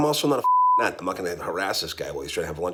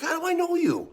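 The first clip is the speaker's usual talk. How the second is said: much higher, baffled reply; puzzled Mark. Retold or imitated. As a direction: play Mark, not placing him at all. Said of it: "He's like".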